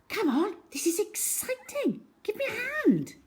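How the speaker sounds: put-on high, eager girl's voice. A narrator's reading. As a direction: hurry him along excitedly. Cherry urges Algy to help.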